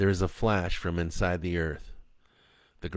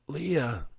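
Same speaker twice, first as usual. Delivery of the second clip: calling Leah's name, higher; 8 semitones higher than usual. A narrator's reading. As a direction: call her name in the dark. Calling wife after crash.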